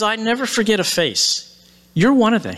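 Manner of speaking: put-on insistent girl. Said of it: "she says".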